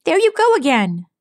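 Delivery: high, bright tomboy pegasus voice; Rainbow Dash, exasperated. A narrator's reading. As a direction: toss it off, exasperated.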